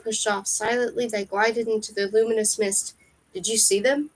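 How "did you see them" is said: plain reading voice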